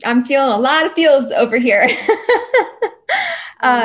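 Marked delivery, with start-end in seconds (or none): 1.9-3.6 teary laugh. Real, not acted. Chair overwhelmed, tearful.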